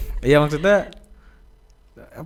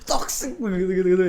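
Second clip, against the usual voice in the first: high loud teasing through laughter; mock him while cracking up; laughing teasing; 7 semitones higher, 7 dB louder than usual. Real, not acted.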